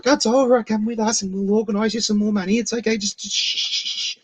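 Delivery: high put-on voice fading to a hush; mock soothing clerk.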